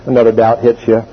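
ordinary talk, nothing marked

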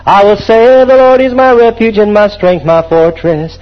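rising, fervent scripture recital; psalm recitation. Acted. Quotes psalm.

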